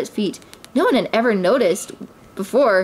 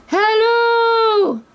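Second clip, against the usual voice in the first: high, loud sing-song hello from up a tree; calling out; 14 semitones higher, 4 dB louder than usual. A narrator's reading. A hidden voice calling out from the tree. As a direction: call hello down from the branches.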